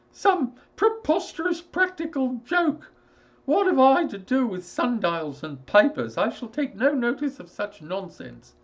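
high, loud, indignant father voice; a father bluffing past his fear; high, loud, blustering father; blustering old father. Acted.

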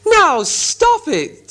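very high, loud, slow troll command; stern troll order. Acted. Tom and Bert ordering the quarrel to end, slow loud troll. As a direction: drag it out firmly. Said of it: "said Tom and Bert together".

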